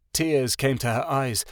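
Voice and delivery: ordinary delivery, nothing marked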